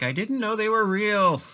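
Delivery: high-pitched plea climbing upward; high, whiny-comic excuse; Lil Pudgy. Acted. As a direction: put on a high little protesting voice. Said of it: "imagining Lil Pudgy going like".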